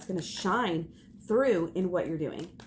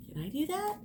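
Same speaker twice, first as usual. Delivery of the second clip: mock question; 10 semitones higher, 4 dB quieter than usual. Imitating an inspired onlooker.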